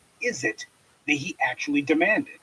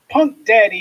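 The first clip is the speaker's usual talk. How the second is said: scornful call-out; 6 semitones higher, 8 dB louder than usual. Real, not acted. Mocking name, raised voice.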